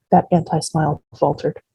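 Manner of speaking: plain reading voice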